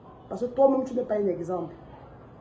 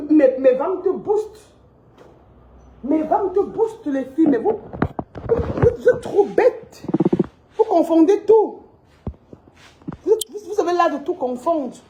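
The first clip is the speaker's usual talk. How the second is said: exasperated scolding; 5 semitones higher than usual. Real, not acted. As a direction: drive it home, half shouting at them. Scolding followers, voice rising.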